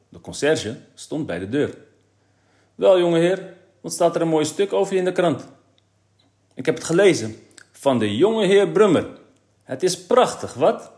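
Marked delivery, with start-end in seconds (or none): 2.7-10.9 high, loud mocking jeer; raised, punchy taunting voice; teasing. A narrator's reading. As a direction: tease the boy with exaggerated admiration. Concierge teases Kees in story.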